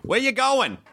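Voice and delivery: put-on high, thick Boston driver accent; exaggerated high Boston accent. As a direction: ham up a Boston cabbie asking where to. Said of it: "the most caricaturized Boston accent".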